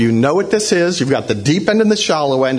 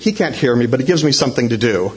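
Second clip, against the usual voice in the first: ordinary talk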